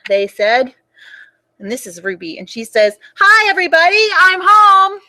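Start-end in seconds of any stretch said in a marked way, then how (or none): 3.2-5.0 put-on high, loud little-girl holler; loud child shout. Ruby bursts in calling to everyone, loud high holler. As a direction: belt it out like a loud kid coming home. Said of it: "She's kind of loud".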